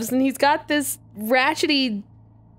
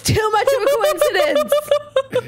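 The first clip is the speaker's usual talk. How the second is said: mock outrage; 8 semitones higher than usual. Fake shock at the coincidence.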